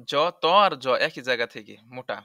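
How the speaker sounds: usual speaking voice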